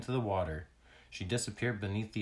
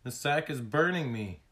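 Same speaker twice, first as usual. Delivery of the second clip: higher, louder complaint of pain; storybook alarm, raised voice; alarmed cry; 5 semitones higher, 5 dB louder than usual. A narrator's reading. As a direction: cry out as if being burned. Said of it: "Tag cried suddenly".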